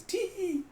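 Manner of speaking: squeaky put-on titter; high tittering "tee". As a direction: let out a very high, drawn-out tee. Voiced giggle of flatterer.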